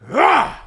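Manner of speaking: imitating a girl's fierce roar as she rips the pig open; short loud roar of effort; mock roar. Retelling how the nerdy classmate tore the jaw open, loud roar. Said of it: "she just goes".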